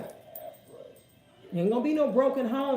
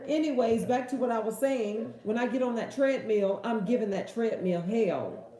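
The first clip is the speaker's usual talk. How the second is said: ordinary talk, nothing marked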